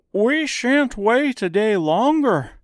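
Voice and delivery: high, loud, slow strawman demand; Scarecrow voice. A narrator's reading. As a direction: insist like an indignant Scarecrow. Scarecrow insists.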